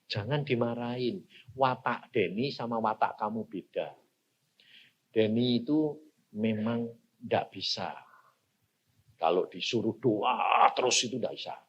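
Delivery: low, soft voice of God; quiet, patient retold counsel; calm divine correction. Retelling God's calm correction.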